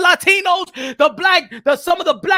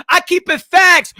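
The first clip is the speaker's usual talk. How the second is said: ordinary talk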